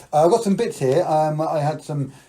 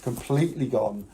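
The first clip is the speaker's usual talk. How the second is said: ordinary talk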